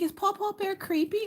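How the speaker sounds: put-on small, very high little-girl voice; imitating tearful child. Crying daughter asks mom about her bear, imitated tearful voice. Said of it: "little tears are falling".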